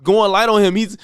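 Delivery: ordinary delivery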